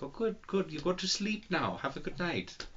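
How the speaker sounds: soft, high imitation of German friends; badly faked German accent, hushed and polite; muddled put-on German accent. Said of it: "what accent you're working on".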